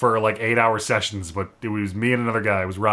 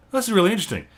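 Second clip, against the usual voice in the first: higher-pitched quoted wonder; intrigued reaction; 9 semitones higher, much faster than usual. Retold or imitated. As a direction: act out a first impression, pitched up. Quoted first-play reaction.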